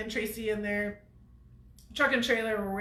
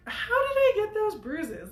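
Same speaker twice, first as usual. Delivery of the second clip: puzzled; 10 semitones higher, 3 dB louder than usual. Retold or imitated. Puzzled at own bruises.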